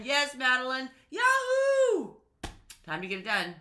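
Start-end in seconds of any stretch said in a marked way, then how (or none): 0.0-2.1 high excited yahoo; cheering. Real, not acted. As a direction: whoop it like a cheer. Cheering along with viewer comment.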